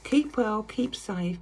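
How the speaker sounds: usual speaking voice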